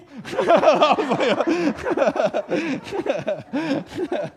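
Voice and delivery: long, loud, high-pitched comic wail; hammed-up wailing laugh. Visitor cracking under the question, hammed-up wail. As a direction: let out a high, silly cackling wail.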